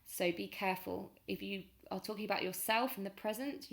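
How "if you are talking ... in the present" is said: usual speaking voice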